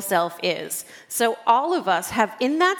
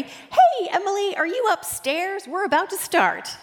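much higher chirpy question, imitating the texter; high, bright texter voice; 9 semitones higher than usual. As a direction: chirp it out like a cheerful organizer. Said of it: "I get a text".

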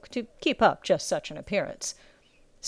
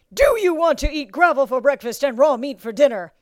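raised, louder drill master yell; bellow; 6 semitones higher, 6 dB louder than usual. A narrator's reading. Drill master testing recruit's will, bellowing. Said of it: "such a bellow to come".

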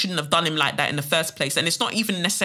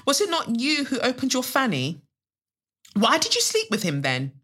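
higher, challenging questions; acted accusation; 7 semitones higher than usual. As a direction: throw it at her as a blaming friend. Voices accusing friends in poem.

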